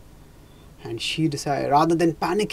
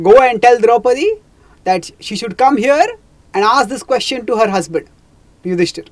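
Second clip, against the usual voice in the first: loud, higher mocking order; sardonic; 7 semitones higher, 10 dB louder than usual. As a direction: sneer the command with a cruel smile. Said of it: "smiled sardonically".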